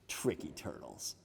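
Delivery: very soft, low near-whisper; hushed aside. A narrator's reading. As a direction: drop to a confiding whisper. Storyteller's sly closing remark.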